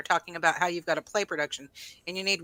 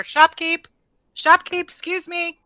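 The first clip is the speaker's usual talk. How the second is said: higher, loud call after the shopkeep; shout out the door; 9 semitones higher, 6 dB louder, slower than usual. Acted. Valet hailing a shopkeeper out the door, shouting. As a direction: call out to flag someone down. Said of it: "can I shout out the door".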